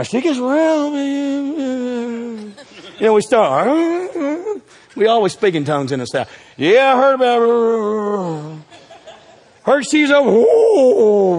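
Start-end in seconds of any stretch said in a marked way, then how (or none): 0.0-4.6 higher, slow put-on country gossip. Preacher mimics country churchgoers gossiping, put-on twang. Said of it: "here's the way we do it in the country". 6.6-11.4 higher, slow put-on country gossip. Preacher mimics country churchgoers gossiping, put-on twang. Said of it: "here's the way we do it in the country".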